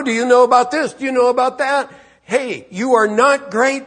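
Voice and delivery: high, insistent challenge; hammering. Acted. Preacher voices God grilling Job, hammering rebuke. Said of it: "the Lord just is hammering Job".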